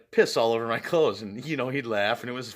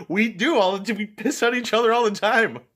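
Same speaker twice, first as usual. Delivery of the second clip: flustered excuse; 9 semitones higher, 6 dB louder than usual. Retold or imitated. Nervous excuse to stranger.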